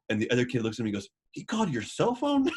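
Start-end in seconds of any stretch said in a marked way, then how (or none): 1.3-2.5 much higher, astonished question; as if a star had phoned him; amazed disbelief. Retold or imitated. Kid amazed Bob phoned him.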